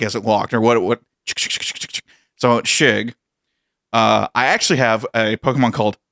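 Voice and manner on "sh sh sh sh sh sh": whispered sh-sh-sh like a crab walking; crab clicking sound. Retold or imitated. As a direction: imitate a crab's tiny clicks. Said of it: "what would a Krabby sound like".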